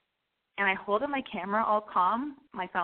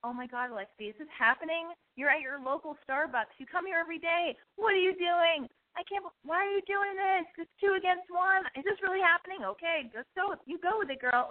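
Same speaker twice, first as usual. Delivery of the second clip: higher, incredulous inner monologue; panicked inner voice; 7 semitones higher than usual. Retold or imitated. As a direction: talk yourself through the panic. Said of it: "I was like".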